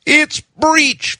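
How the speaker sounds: very high, voiced stage-whisper of alarm; mock horror, pitch leaping right up; horrified. A narrator's reading. Wife spots a breech lizard birth, horrified. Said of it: "my wife whispered, horrified".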